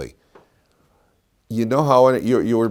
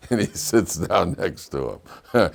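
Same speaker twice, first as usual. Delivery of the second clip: ordinary talk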